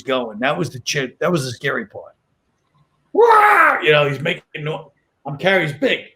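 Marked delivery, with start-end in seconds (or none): 3.1-3.8 sudden high wailing yelp; imitating the dog's yelp; yelping dog cry. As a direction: let out a dog's wail. Dog reacts at the vet.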